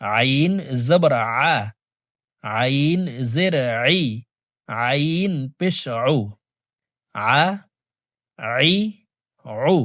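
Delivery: "আ, আই, উ" squeezed from the middle of the throat; ayn from mid-throat. Shown as a demonstration. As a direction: voice "আ, আই, উ" as Arabic ayn, not a hamza. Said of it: "হলকের মধ্যখান থেকে হবে". ("আ, আই, উ" হলকের মধ্যখান থেকে উচ্চারণ)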